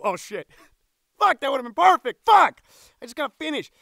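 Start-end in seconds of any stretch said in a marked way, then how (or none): none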